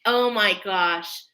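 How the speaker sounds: ordinary talk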